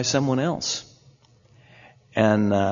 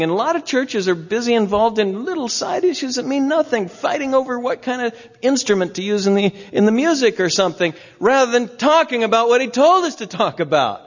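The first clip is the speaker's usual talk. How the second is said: preaching at full force; 10 semitones higher than usual. Real, not acted. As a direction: drive the point home to the congregation.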